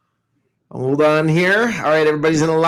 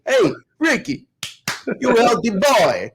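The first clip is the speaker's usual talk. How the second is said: Chicago Italian aunt; 7 semitones higher than usual. Retold or imitated. Nephew imitates his aunt's teasing, higher Chicago Italian accent. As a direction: draw out "you healthy boy" like a Chicago Italian aunt. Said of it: "insulted by your accent".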